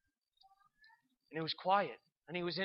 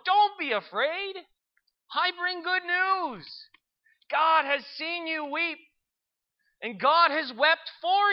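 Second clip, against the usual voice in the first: proclaiming angel; 6 semitones higher than usual. Acted. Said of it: "the angel said to him".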